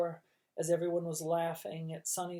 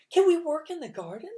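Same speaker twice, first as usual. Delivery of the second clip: higher, louder eager question; eager child; 11 semitones higher, 9 dB louder than usual. A narrator's reading. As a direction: ask eagerly.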